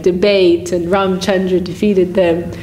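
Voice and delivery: ordinary talk, nothing marked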